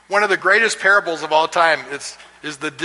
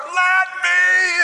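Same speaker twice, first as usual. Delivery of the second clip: high, urgent mock shout; hammed-up shout through a door; shouting Peter; 3 dB louder than usual. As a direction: yell to be let in. Peter pounding, shouting to enter.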